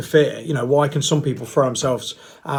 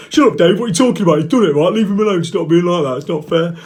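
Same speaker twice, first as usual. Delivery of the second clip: higher, louder, fast mock telling-off; mock indignant viewer; 4 semitones higher, 6 dB louder than usual. A viewer scolding Dave, mock indignant, fast and loud. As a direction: scold Dave in a put-on whiny voice. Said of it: "some people are gonna like that".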